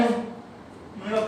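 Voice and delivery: usual speaking voice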